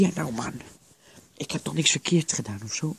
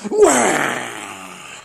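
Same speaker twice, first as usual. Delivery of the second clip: loud, drawn-out "waah" yell; jump-scare them with a long yell; sudden loud holler; startling scream; 3 semitones lower, 10 dB louder than usual. Acted. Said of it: "je laat me schrikken".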